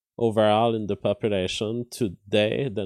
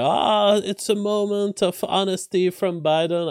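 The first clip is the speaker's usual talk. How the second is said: high, louder mock cheer of a pundit; mock gleeful pundit; 9 semitones higher, 4 dB louder than usual. Streamer mocking the right's gleeful reaction, high and loud. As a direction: ham up the right's delight.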